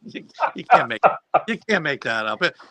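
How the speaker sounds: cracking up mid-story; laughing. Real, not acted. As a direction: laugh helplessly while getting the words out.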